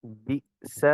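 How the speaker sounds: بئس with a short throat catch, soft; correct hamza. Shown as a demonstration. Teacher models the hamza for students, soft and exact. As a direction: say بئس with a clean glottal stop. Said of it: "جھٹکے سے پڑھیں گے مگر ناف نہیں".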